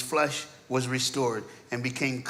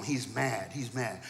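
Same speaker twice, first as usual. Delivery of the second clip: 1 low muttered repeat; 5 semitones lower, 9 dB quieter, slower than usual. A narrator's reading.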